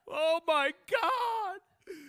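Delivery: loud, high, drawn out; dramatic cry. Real, not acted. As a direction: wail it out. Dramatic exclamation.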